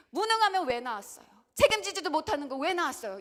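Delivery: loud, high, fast accusing shout; child's angry complaint. Retold or imitated. Child blaming parents, loud and fast. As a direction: throw the blame at the parents. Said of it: "이렇게 하소연을 하지만".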